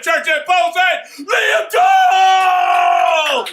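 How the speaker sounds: high, loud, drawn-out name announcement; ring announcer shout. Pretend. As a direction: bellow the winner's name, stretching the last word.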